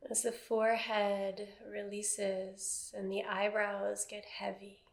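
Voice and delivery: calm, even meditation voice with long silences; guided meditation. Acted. Teacher settling a seated class into body awareness, guided meditation. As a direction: guide them gently into stillness, phrase by phrase.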